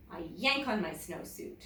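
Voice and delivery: ordinary delivery, nothing marked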